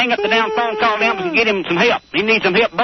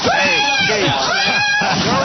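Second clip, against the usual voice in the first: urgent shout; much slower than usual. Real, not acted. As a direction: shout to grab attention. Host calling out urgently.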